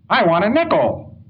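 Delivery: a lightly voiced kid insisting on what he wants; mock child digging in his heels; demanding child. Child demanding nickel from mother.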